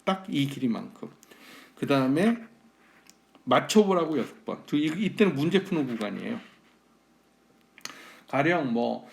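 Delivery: ordinary delivery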